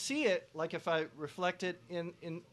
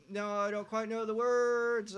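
higher, slow, hesitant singer's line; slow off-mic demo; 6 semitones higher, 5 dB louder, slower than usual. Shown as a demonstration. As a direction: sound unsure of the lyrics, slowly.

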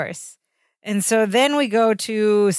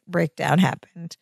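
usual speaking voice

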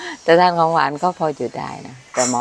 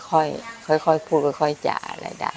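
slow, softer, stretching each word; slow and gentle; 4 dB quieter, much slower than usual. Shown as a demonstration. Host tells viewers she likes quiet things, slow and softer. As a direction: show how calmly she likes to talk.

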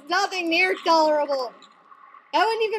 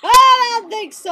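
loud, higher burst of defiance; defiant shout; 4 semitones higher, 9 dB louder than usual. Real, not acted. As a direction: shout it back at an enemy. Defies enemies in the game.

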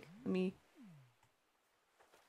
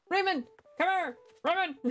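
calling out; 8 semitones higher than usual. Real, not acted. Streamer calling her favorite villager over. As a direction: call him over eagerly.

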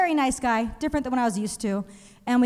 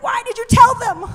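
high, loud mock scold from mom; 11 semitones higher, 9 dB louder than usual. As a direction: shriek it like an alarmed mother. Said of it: "My mom would be like".

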